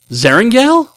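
rising to a high shout; indignant yell building up; mock outrage. Reading listener's outraged name correction.

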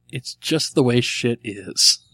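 slow, deliberate answer; slow reading. Real, not acted. Host reading a listener's punchline, slowly and deliberately. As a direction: spell it out slowly, word by word. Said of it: "With the right inflection".

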